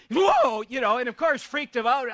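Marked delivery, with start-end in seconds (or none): 0.1-0.6 sudden loud startled whoa, pitched way up. Retold or imitated. Son waking to his dad's face, a loud startled whoa. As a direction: jolt awake and cry out whoa.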